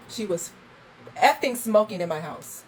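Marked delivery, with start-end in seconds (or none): 1.2-2.6 louder, higher furious burst; angry outburst. Real, not acted. Host raging that the guest smoked indoors, loud angry outburst. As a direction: let the outrage explode on effing.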